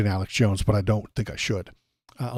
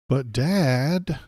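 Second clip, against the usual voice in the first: higher, drawn-out plea; protesting son; 4 semitones higher than usual. Acted. Barron protesting to his dad, higher drawn-out whine. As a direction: plead like a thwarted kid.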